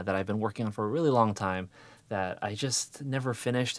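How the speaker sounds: ordinary talk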